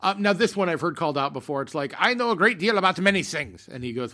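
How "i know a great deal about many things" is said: hammed-up stage German, higher and louder; horrible German accent. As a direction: declaim it in a bad German accent. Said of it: "putting on my horrible German accent".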